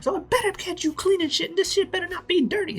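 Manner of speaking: very high, loud falsetto woman; bossy pirate lady. Acted. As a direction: screech orders at the crew like a tyrant. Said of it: "We cut to this lady".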